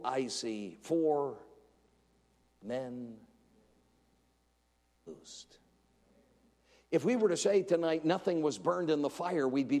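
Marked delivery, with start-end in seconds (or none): 0.0-5.6 slow, awed, dropping away to a breath; slow awestruck words sinking to a hush; awed, fading. Acted. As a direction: let the wonder trail off into a whisper. King's awed quote, fading.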